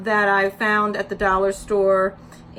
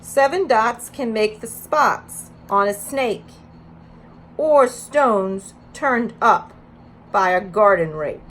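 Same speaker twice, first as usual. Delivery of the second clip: bright, louder storybook read-aloud; reading to children; 2 semitones higher, 7 dB louder, slower than usual. Acted. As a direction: read it out to a preschool circle. Reads picture book aloud.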